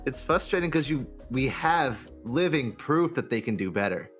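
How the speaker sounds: ordinary talk, nothing marked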